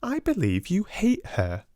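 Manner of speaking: put-on high girlish schoolgirl voice; teasing Jessie. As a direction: play the gossipy younger girl.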